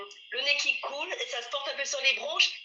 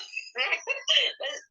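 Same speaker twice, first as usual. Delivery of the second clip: a high, light laugh of denial; amused laugh; 11 semitones higher than usual. Real, not acted. Asked whether she is the one leading, high amused laugh. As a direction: laugh off the idea with a high giggle.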